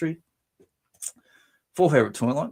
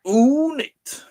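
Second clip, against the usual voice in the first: high, loud drawn-out ooh; delighted exclamation; 5 dB louder than usual. Real, not acted. Reacting to a viewer's toy collection, delighted. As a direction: coo with delight, pitched way up.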